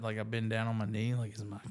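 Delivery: ordinary delivery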